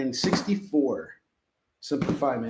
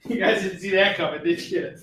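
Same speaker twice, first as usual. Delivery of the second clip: laughing; 3 semitones higher than usual. Real, not acted. Still laughing after joke.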